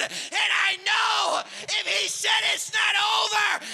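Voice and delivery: high, loud, building pulpit shout; rally the congregation at the top of your voice; full-force preaching. Real, not acted.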